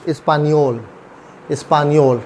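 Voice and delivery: "स्पानियोल" with a y glide after n; ny sound. Shown as a demonstration. Teacher repeating the right form, ny sound. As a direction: repeat "स्पानियोल", falling, n melting into y. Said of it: "एन और वाई की sound".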